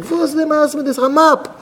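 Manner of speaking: high-pitched incredulous question; acted exasperation, voice jumping high; indignant outburst.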